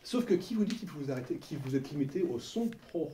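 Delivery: ordinary talk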